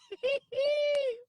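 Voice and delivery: high squealing giggle; helpless laughing. Real, not acted. Streamer joking about the woman on screen, high squealing laugh. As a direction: break into a long, high laugh. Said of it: "why I'm laughing so hard".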